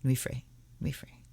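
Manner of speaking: "Nui Fray, Nui Fray" quick and hushed, run together; said rapidly. Shown as a demonstration. Reader practising a glossary term, "Nui Fray, Nui Fray" said rapidly. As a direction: rattle off "Nui Fray, Nui Fray" twice under the breath. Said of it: "And said rapidly".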